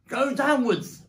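high, loud command; shouted order. Acted. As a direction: order the family urgently. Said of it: "ordered Mister Fox".

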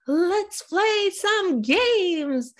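high, drawn-out gleeful invitation; mock excited. Actress mimics Tarantino wanting board games, mock excitement. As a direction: stretch every word like an overexcited kid. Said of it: "was very excited".

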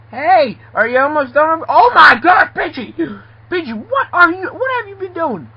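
high, loud outraged cry; shocked scolding. Acted. Boss finding Pidgey's work undone, shocked high yelling. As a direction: shriek in shock at a slacker. Finds Pidgey has not cleaned.

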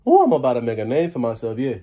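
put-on proud Cajun accent, steady and pleased. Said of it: "They're making fun of my accent".

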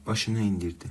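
ordinary delivery, nothing marked

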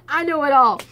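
loud, smug know-it-all boast; cocky. Retold or imitated. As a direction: brag it like you know everything. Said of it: "So I was pretty cocky".

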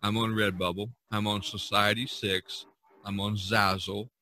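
usual speaking voice

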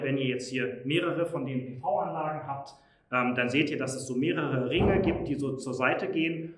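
normal talking voice

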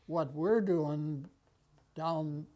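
ordinary talk

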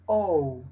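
"O" open, with a very relaxed U glide; "O" abierta y relajada. Shown as a demonstration. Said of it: "con la U muy relajada, muy abierta".

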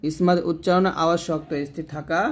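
ordinary talk, nothing marked

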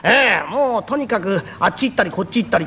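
usual speaking voice

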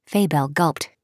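usual speaking voice

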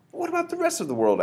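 high, loud alarmed cry; the boy cries out worried; cried out. Acted. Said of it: "I cried".

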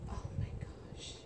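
awed whispered exclamation. Real, not acted. Awed by the Sarah Louise outfit, whispered. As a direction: breathe it out in awe. Amazed by the gift.

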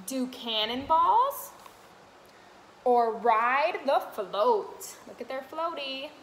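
sing-song picture book read; reading to children. Acted. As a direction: read the rhyme brightly to little ones.

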